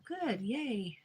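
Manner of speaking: normal talking voice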